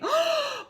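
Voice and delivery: very high sharp gasp; shocked gasp. Acted. Little blue fish gasping in shock.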